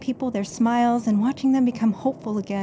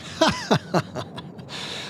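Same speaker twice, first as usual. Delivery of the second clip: tantalizing laugh; 2 semitones higher, 6 dB quieter than usual. Acted.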